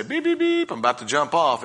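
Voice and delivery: squeaky high beeping warning, called out loud; talking saw blade. Retold or imitated. Preacher voicing the sawmill blade, high mock beeping warning. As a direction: play the bandsaw blade chirping a cheery warning it never gives. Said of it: "It doesn't say".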